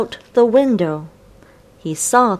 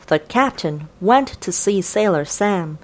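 usual speaking voice

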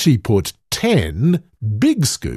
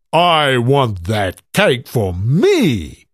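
greedy giant voice; slower than usual. A narrator's reading. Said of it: "said the giant".

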